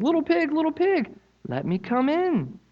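high, put-on wolf voice; sly wolf. Wolf at the straw house door, high sly voice. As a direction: coax like a sneaky cartoon wolf. Wolf coaxes second pig.

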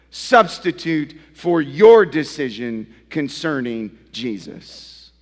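loud, rising sermon delivery, pressing each phrase; preaching at full force. Acted. Pastor challenging his congregation, preaching loud and high.

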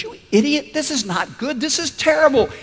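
high, outraged mock yell; king going ballistic. Injured king blowing up at his optimist friend, acted high-pitched outburst. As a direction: erupt in indignation as the furious king. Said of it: "the king goes ballistic".

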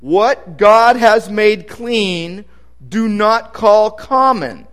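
very slow, weighty divine words; slow solemn pronouncement. Acted. Voice from heaven corrects Peter, slow and solemn. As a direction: lay each word down slowly, solemnly.

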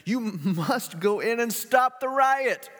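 high, loud, slow pleading order; desperate governor. Acted. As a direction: insist she must go in. Governor orders her into riot.

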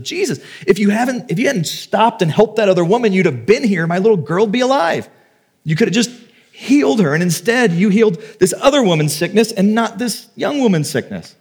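high-pitched, pleading accusation; acted reproach. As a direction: voice a grieving parent demanding answers. Voicing grieving parents' questions to Jesus.

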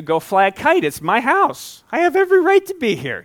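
hammed-up outrage defending his own house; a preacher playing an offended homeowner, loudly indignant; mock indignant retort. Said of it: "I'm gonna tell you".

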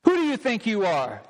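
contemptuous jeer; taunting sneer. Shown as a demonstration. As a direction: sneer down at him. Crowd taunting Jesus.

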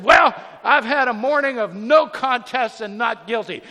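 very loud, high, exasperated roar; retold enraged judge. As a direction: imitate a judge at the end of his rope. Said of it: "he's bright red".

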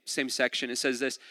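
usual speaking voice

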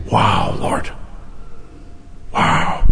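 whispered wonder in prayer; awed whisper. Real, not acted. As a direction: whisper it to God in awe. Awed aside addressed to God.

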